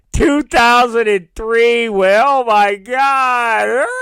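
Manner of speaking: drawn-out fake bawling; hammed-up wail of a grieving fan; mock sobbing. Mocking people posting old convention photos.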